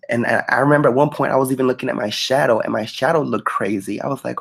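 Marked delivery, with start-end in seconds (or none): none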